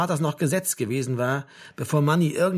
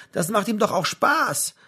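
higher, slow, mildly desperate plea; a bit desperate; 9 semitones higher, 4 dB louder, slower than usual. A narrator's reading. Mother defending her son's father, a bit desperate. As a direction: plead with a touch of desperation. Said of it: "es klang ein bisschen verzweifelt".

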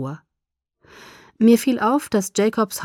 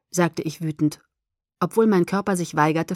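ordinary delivery

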